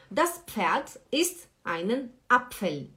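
usual speaking voice

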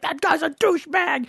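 high-pitched indignant devil. Acted. Devil looking at guys in heaven, indignant. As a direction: protest as an outraged comic devil. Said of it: "guys slipped through the devil's fingers".

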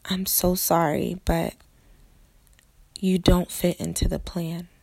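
ordinary talk

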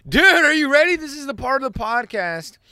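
high, loud hype intro; hyped announcement. Real, not acted. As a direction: announce it like a host. Hyped podcast segment intro.

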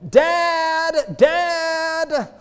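put-on high calling boy; boy calling his father at the window. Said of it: "starts calling".

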